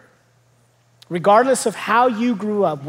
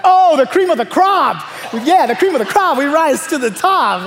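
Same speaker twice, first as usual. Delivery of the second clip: loud, higher "Oh" as it clicks; excited realization; 6 semitones higher, 4 dB louder than usual. Retold or imitated. Kids finally understanding dad's saying, loud delighted realization. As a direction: burst out with the corrected "crop".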